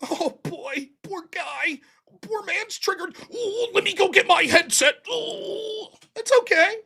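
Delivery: high, sing-song fake sympathy; mock pity. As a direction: coo at him like a hurt child, sarcastically.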